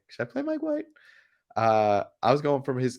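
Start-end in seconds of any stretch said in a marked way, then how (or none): 0.1-0.8 mock self-question. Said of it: "I was like".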